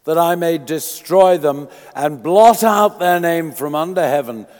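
fervent sermon reading; preaching at full force. Acted. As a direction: proclaim the scripture to the congregation. Preaching and reading scripture aloud.

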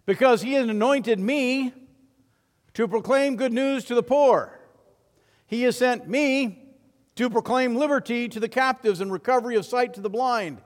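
Jesus reads Isaiah aloud to his hometown; Jesus reading scripture. Retold or imitated. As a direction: proclaim the prophecy to the synagogue. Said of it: "And Jesus began to read".

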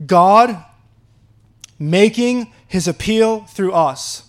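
GOD punched loud, then making his appeal drawn out slow; God hit hard then slow; stressed God, slow rest. Acted. Preacher rereads verse with weight.

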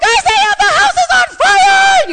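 high, very loud drawn-out scream; screaming alarm. Retold or imitated. Shouting to Isaiah that the house is on fire, screaming. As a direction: scream out a panicked warning to the house. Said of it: "I started screaming".

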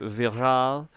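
"viral" said the French way, vee-RAHL; mock French pronunciation. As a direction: say "viral" as if it were French, questioningly. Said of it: "how do you say".

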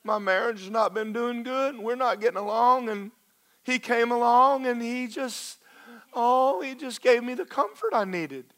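high, pleading voice of a straying wife; pleading woman's voice. Pretend. Preacher voicing a wife who strayed, high and pleading. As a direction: whine your excuse, high and hurt.

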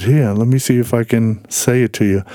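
normal talking voice

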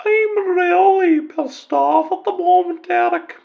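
angry football fan in a high mock female voice; falsetto woman caller. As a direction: squeak it out like a fed-up lady fan.

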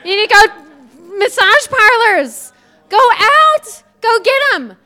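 high, loud, slow rallying call; preacher urges congregation to go, loud and high; rallying shout. Real, not acted. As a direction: fire them up to go out.

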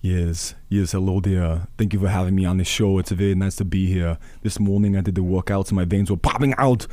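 lightly voiced Arnold Schwarzenegger; a hint of the Austrian strongman; Arnold impression. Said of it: "that Arnold was on the way in".

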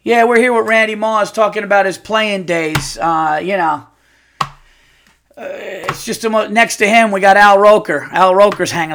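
loud, mocking TV host voice; mock yelling. Mocking an ESPN interview with a shout. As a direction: bellow it like a ridiculous sports anchor. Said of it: "I'm yelling".

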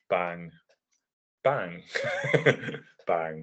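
"bang" said slowly with long pauses between each; slow spaced bangs. Shown as a demonstration. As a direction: drag "bang... bang... bang" out with long gaps. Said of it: "as opposed to, like, a New Japan".

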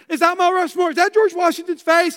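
high, eager questions imitating his wife; excited wife. Wife asking husband about every rock, imitated excitement. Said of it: "she was excited".

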